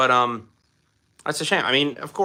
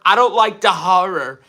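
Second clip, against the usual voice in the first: higher, louder Brooklyn-style "HAH-ruh"; mock Brooklyn accent; 6 semitones higher, 6 dB louder than usual. Shown as a demonstration. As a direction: put on a punchy Brooklyn accent. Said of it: "the way you said horror".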